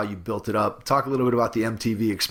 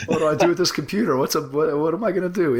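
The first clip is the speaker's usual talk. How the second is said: mock panic; 6 semitones higher, 3 dB louder, faster than usual. Host mimics the panic.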